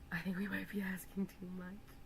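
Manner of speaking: unvoiced whisper of a second thought; whispered aside. Real, not acted. Streamer doubting her model order to herself, whispering. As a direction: hush it to yourself.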